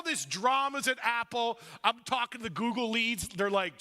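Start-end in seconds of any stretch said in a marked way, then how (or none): none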